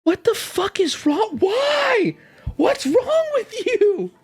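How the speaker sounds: high, stunned and half laughing; horrified disbelief. Retold or imitated. Retelling how she reacted to her dad's caved-in bed. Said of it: "And I'm like".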